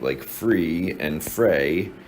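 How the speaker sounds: free and fray said very slowly, vowels apart; minimal pair, slowly. Shown as a demonstration. Teacher to class, slow minimal pair demo. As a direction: stretch each word to show the vowel change. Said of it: "that's called a minimum pair".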